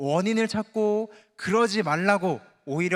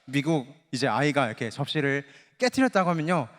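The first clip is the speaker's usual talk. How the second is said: ordinary talk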